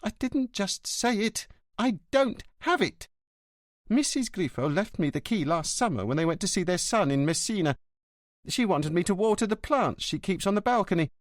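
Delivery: indignant gossiping porter; bristling concierge. Acted. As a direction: snipe at the neighbours resentfully. Concierge voiced.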